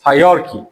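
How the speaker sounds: hierarchy as "hai-YAR-ki"; corrected pronunciation. Shown as a demonstration. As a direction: repeat hierarchy with "yar" in the middle. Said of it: "Actually, it's hierarchy".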